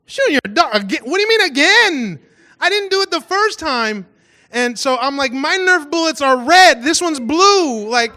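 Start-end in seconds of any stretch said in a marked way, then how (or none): 0.0-4.1 indignant. Retold or imitated. Indignant reply to accusing note. 5.3-7.9 mock indignant. Defending himself, getting upset.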